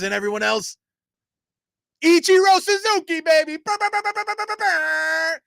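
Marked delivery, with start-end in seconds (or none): 2.0-3.6 high hyped-up announcer call; excited shout. Real, not acted. Hyping a Hall of Famer. 3.6-5.4 vocal fanfare. Pretend. Imitating a fanfare after name.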